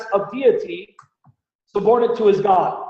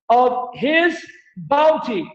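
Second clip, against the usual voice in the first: "his" drawn out slow and leaned on; slow down and hit "his" in "of his bounty"; slow reading that leans hard on "his"; stress on his; much slower than usual. Shown as a demonstration. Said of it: "Again, the singular".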